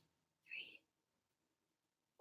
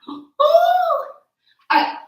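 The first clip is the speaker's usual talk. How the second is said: excited; 6 dB louder, much slower than usual. Real, not acted. Excited before next exercise.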